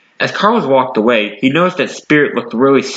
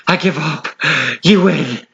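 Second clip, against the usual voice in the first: out of breath; 4 semitones higher than usual. A narrator's reading. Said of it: "said Spirit out of breath".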